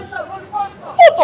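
lightly voiced copy of the clip's shout; imitating the Jamaican man. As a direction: echo his football call in a light impression. Echoes the Jamaican man's shout.

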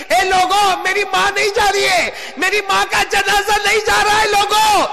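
high sermon cry, rising to a shout; anguished preaching. Acted. Bereaved son crying out to the people, anguished preaching. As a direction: cry out the loss at full pitch.

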